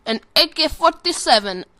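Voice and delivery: high, loud mock Russian accent. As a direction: punch it out in a fake Russian accent. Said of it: "talk in a Russian accent".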